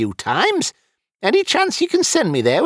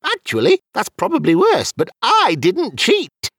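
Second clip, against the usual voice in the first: argumentative; 13 semitones higher, 3 dB louder than usual. Acted. Wizard still arguing his innocence.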